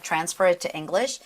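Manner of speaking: ordinary talk, nothing marked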